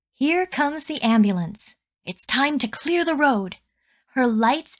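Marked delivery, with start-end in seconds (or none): none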